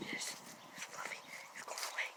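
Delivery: soft, fast whisper. Real, not acted. Telling Fluffy he is going away, a quick whisper. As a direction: whisper it to the cat.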